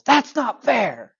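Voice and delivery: put-on high, slow, drawn-out kid's yell; mock tantrum scream. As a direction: imitate a boy yelling at his dad. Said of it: "He screams at his dad".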